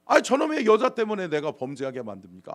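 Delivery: high, protesting complaint; aggrieved Adam accusing God; resentful blaming. Acted. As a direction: push the blame onto someone else, indignantly. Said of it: "하나님을 원망하잖아요".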